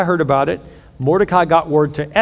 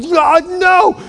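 very high, drawn-out wail; hammed-up cry of dismay; horrified refusal; 12 semitones higher, 4 dB louder than usual. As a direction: recoil from it at the top of your voice. Haman's horrified reaction, acted.

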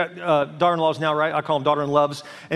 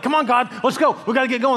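higher, mock-impatient hurrying; impatient urging; 6 semitones higher than usual. Believer hurrying God along, mock impatience. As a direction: chivvy someone along, pitched up.

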